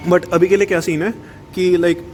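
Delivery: Hinglish, fast casual chatter; hindi english mix. Real, not acted. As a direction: slide between Hindi and English mid-sentence. Casual Hindi English vlog chat.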